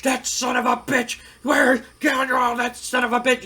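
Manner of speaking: a boss yelling that he's sick of the rebel; furious cussing. Retold or imitated. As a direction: blow your top and cuss him out. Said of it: "he's just mad and cussing up a storm".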